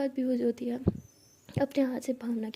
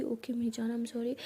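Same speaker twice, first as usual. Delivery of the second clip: tender apology; 2 semitones lower, 7 dB quieter than usual. Acted. Pihu hugs crying girlfriend, tender apology. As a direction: comfort her gently, quietly. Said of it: "Pihu उसे हक करते कहती".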